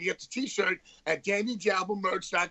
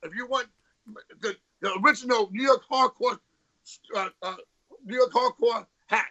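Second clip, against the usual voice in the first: upbeat merch plug, slightly raised; ad read; 4 semitones higher than usual. Acted. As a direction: sell it like a radio ad. Plugs merch like an ad.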